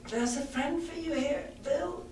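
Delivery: put-on high, soft, shaking boy's call; trembling voice. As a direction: announce it while terrified. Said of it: "in a trembling voice".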